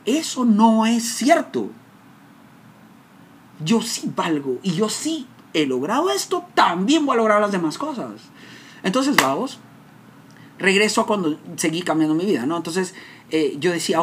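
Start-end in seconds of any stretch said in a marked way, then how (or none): 0.1-8.1 defiant vow. Retold or imitated. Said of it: "Y ese día yo dije".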